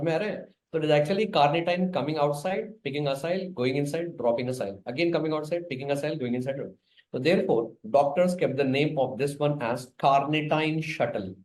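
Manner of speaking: usual speaking voice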